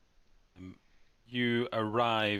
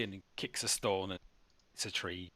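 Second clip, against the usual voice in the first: ordinary talk, nothing marked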